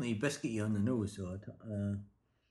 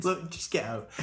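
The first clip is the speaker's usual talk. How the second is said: loud laughing fit; can barely talk for laughing; 6 semitones higher, 3 dB louder, slower than usual. Real, not acted. Giggle fit over the town name.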